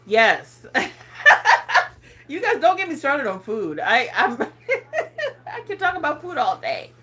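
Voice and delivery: ordinary delivery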